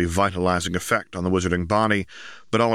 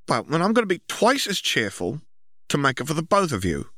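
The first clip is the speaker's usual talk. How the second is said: put-on higher girl's voice, bright resolve; decide to be cheerful as Ginny, pitched up; Ginny voiced higher, cheerful; 9 semitones higher than usual. A narrator's reading. Ginny voiced in a read story.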